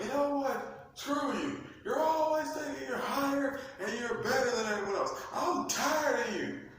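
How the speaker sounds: high, loud, fast indignant whine; angry whiny Lucifer. Acted. Lucifer snapping at God, high indignant whine. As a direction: spit it out like a sulking rebel.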